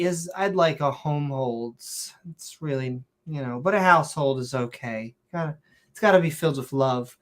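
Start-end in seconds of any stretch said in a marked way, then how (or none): none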